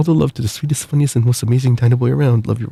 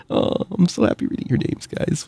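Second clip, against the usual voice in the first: giggly happy; 5 semitones higher, 5 dB quieter than usual. Real, not acted. Streamer overjoyed reading supporter names.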